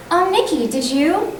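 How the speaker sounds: talking to best friend. Shown as a demonstration. Voice actor demos chatting to bestie Nikki, friendly and high. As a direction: greet your best friend warmly. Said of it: "imagine that I'm talking to my best friend".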